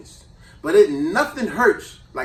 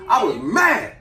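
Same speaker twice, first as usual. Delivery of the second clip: ordinary talk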